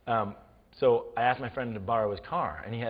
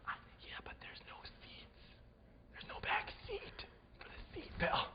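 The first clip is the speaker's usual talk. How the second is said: worried whisper; 16 dB quieter than usual. Retold or imitated. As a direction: whisper the protest, very softly. Friend's scared aside in back seat.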